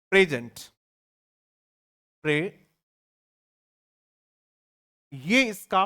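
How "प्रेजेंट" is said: प्रेजेंट with stress on the first syllable, PRE-zent; adjective pronunciation. Shown as a demonstration. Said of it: "phonetic transcription जो है वो ये है". (प्रेजेंट को विशेषण की तरह, पहले सिलेबल पर ज़ोर)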